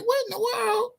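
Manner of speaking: very high, stunned "what in the world"; incredulous. Real, not acted. Fan baffled by lopsided trades, high and stunned. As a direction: gasp it out in disbelief.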